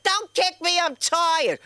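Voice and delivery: high, loud mock whine of a tired husband; whiny husband. Husband voiced whining in class.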